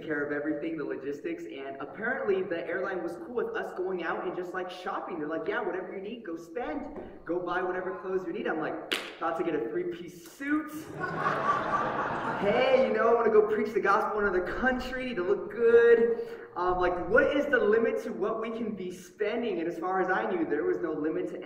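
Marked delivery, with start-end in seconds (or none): none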